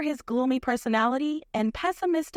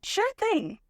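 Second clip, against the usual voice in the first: usual speaking voice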